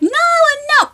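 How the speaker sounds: high, panicked cry of no as she slips. Retold or imitated. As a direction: yelp it out while being dragged. Dog drags her, she protests.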